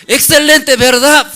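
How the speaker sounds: high, loud hammed-up MC hyping the crowd; overbearing master of ceremonies, shouted high; pushy presenter. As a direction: play the host forcing applause. Imitating pushy presenter again.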